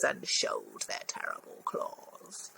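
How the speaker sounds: whispered menace on the claws; spooky whisper. A narrator's reading. Reader whispering to children about the terrible claws. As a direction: hush it like a secret threat.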